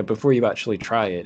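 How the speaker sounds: ordinary talk, nothing marked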